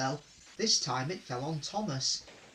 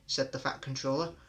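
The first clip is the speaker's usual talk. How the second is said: ordinary delivery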